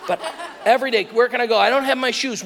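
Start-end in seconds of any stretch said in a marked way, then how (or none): 1.0-2.4 higher, louder mock child's complaint; imitated daughter. Daughter to dad before leaving, imitated high-pitched complaint. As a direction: play the child wailing a little over missing shoes. Acts out daughter missing shoes.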